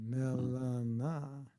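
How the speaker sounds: "Melana" very slow, syllable by syllable; drawn-out pronunciation. Shown as a demonstration. Host puzzling over a name, "Melana" drawn out very slowly. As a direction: stretch every syllable of "Melana": meel-laan-naah. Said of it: "is that pronounced properly".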